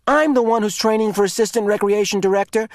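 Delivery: high, loud indignant retort; angry. A narrator's reading. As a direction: put him in his place. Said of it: "Asher pointed out angrily".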